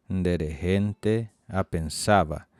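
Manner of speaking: "nderehente apensava" drawn out slowly; slow clear model. Shown as a demonstration.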